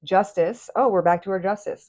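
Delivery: ordinary delivery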